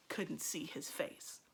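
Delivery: plain reading voice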